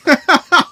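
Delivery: high burst of real laughter. Real, not acted. Streamer laughing at himself to chat, high burst of laughter. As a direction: crack up at his own confession.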